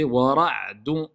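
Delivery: slow, drawn-out tajwid chant; Quran recitation. Shown as a demonstration. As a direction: recite it slowly with long prolongations. Teacher recites Quran verse. (récitation lente du Coran)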